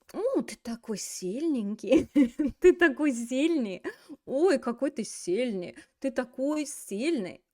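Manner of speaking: playful, slow gushing with a giggle; swooning admiration. Acted. Teacher plays someone squeezing a guy's muscle, mock-swooning admiration. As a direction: coo over his strength, hammed up.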